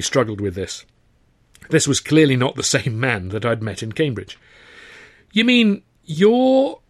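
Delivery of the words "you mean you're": slow, high disbelief; astonished. Acted. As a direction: trail off in amazement. Young man astonished.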